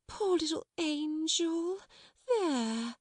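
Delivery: high, slow, soothing coo. A narrator's reading. Miss Amelia coaxing Lottie on the floor, cooing. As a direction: draw it out tenderly for the child.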